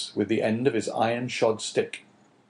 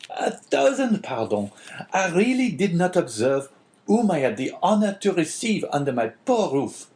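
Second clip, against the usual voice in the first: bow and scrape to the guest, pitched up high; eager innkeeper; 7 semitones higher than usual. Acted. Caderousse apologises to the abbé.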